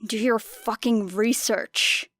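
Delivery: ordinary delivery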